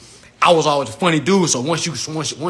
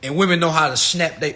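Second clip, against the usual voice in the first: normal talking voice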